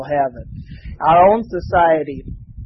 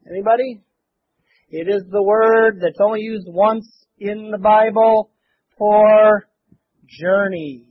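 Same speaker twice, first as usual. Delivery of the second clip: ordinary talk, nothing marked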